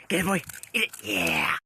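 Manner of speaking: ordinary talk, nothing marked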